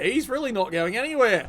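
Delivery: ordinary delivery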